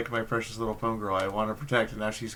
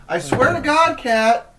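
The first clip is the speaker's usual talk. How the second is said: much higher, much louder exasperated shout; yelling at cat; 13 dB louder, slower than usual. Real, not acted. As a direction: scream at the cat to get off.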